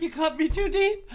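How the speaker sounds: high, loud hammy dying whine; overacted death scene. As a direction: overplay a dying man. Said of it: "He's like".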